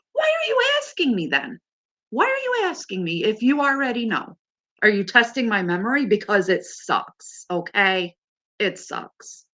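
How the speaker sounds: exasperated comeback, starting very high; acted-out indignant questioning; retold agitation. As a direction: replay an irritated outburst, high at first, then settling.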